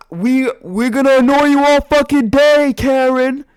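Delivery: loud, high jeering shout at the neighbour; taunting yell. Retold or imitated. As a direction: yell it like a bratty kid taunting her. Taunting the lady, kids screaming.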